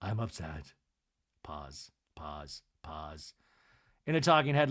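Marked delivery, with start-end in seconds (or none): 0.0-0.7 low, subdued statement, sinking softer; dropped pitch, fading on the repeat; retold upset. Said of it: "And then Lisa goes". 1.4-3.3 very soft, low, spaced-out words; deadpan low aside; hushed stage direction. A narrator's reading. Recapper reads out silences.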